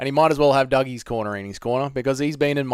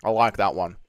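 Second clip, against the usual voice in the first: ordinary delivery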